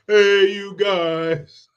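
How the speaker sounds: slow, high, drawn-out "Hey, you guys"; Sloth impression. A host tosses in a Goonies line, slow high Sloth voice. Quoting a famous movie line.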